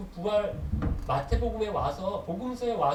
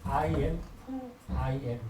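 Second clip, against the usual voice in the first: very slow; 4 semitones lower, much slower than usual. Shown as a demonstration. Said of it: "영어로".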